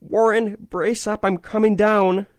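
high, loud resolute shout. A narrator's reading. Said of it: "I formed and shouted my resolution".

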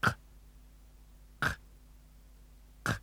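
"K" popped deep in the throat; far-back K. Shown as a demonstration. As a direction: burst "k" with tongue pulled right back. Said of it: "shove the back of your tongue as far back".

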